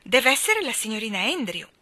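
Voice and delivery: put-on loud, excited child's voice; thrilled. A narrator's reading. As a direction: announce it eagerly. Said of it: "gridò Michael elettrizzato".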